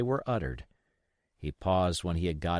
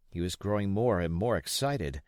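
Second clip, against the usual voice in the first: usual speaking voice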